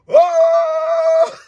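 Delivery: high, loud mock groan of dismay; mock crowd groan. Host mocking the crowd reacting to the misspelling, high mock groan. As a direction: imitate a stadium groaning.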